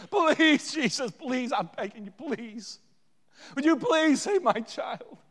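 high, begging cry that ebbs and surges; loud, anguished mother's plea; desperate pleading. Acted. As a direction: beg for a child's life. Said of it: "I think she said".

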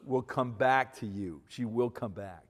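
usual speaking voice

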